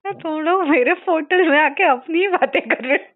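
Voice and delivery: high, loud, grumbling grandpa voice; grandfather's complaint. Retold or imitated. Medium relays grandfather left standing, loud high grumble. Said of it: "आपके दादाजी बोलते हैं".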